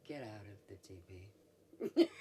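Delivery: slow, loud inner voice, lightly voiced; a voice from somewhere in her head giving an order; the mysterious voice in her head, slow and loud; inner voice command. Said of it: "the voice that I, in my head".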